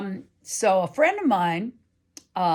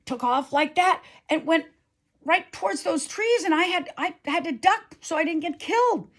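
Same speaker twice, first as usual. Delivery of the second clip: much higher, worked-up defense; indignant retort; 10 semitones higher than usual. Retold or imitated. Katie protesting to her friend, high and worked up. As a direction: insist on it, still rattled.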